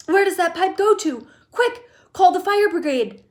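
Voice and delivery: high loud urgent demands; panicked. A narrator's reading. Panicked mother demanding help.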